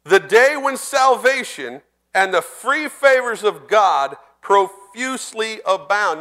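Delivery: slow, relishing reread; savoring the verse. Real, not acted. Preacher rereading favorite verse slowly. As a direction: dwell on each word of it. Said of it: "I like that".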